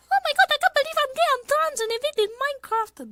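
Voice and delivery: put-on very high, fast anime girl voice. Streamer finally landing the Miku voice, very high. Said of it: "There we go, there's Miku".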